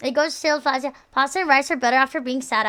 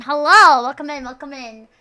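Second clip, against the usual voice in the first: big, loud hello; loud greeting; 8 dB louder than usual. Real, not acted. Streamer welcoming viewers who just joined, loud greeting.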